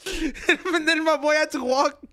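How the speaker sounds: can barely get it out for laughing. Real, not acted.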